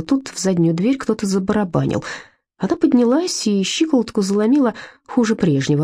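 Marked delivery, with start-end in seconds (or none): none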